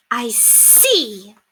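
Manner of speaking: high, imperious society lady; pointed, high-pitched mistress; haughty lady. Acted. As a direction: look down your nose at the maid. Lady Constance answers coolly.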